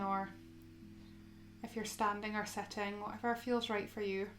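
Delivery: soft, unhurried yoga guidance with long pauses; guided meditation. Acted. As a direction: gently guide them into stillness, phrase by phrase.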